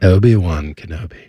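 a posh British radio voice; British accent. Acted. S0 answering S1's British bit with a British accent. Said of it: "a British sports radio station".

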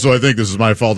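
low-voiced imitation of a guilty colleague; sheepish confessing coworker. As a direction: admit it in his deep, hapless voice. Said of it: "he goes".

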